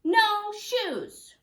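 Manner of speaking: high, loud defiant shout; childish yell of no shoes. Acted. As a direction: stamp it out like a cross toddler.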